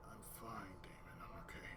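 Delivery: low, hushed whisper; whispered reassurance. Retold or imitated. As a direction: whisper it to calm a friend. Retold answer to Damon.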